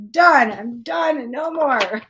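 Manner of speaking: one sharp, loud word; emphatic done. Real, not acted. Reader swears off dark nights of the soul, loud done. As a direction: slam the door on it. Vowing to stop calling darkness.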